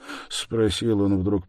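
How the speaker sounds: ordinary delivery, nothing marked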